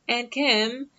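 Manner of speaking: imitated hail to get someone's attention; calling from behind. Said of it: "hear a voice behind".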